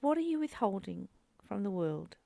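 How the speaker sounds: ordinary talk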